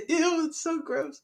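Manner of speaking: high-pitched squeamish reaction; squealed disgust; grossed out. Real, not acted. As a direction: recoil in disgust, voice shooting up. Grossed out by co-host's joke.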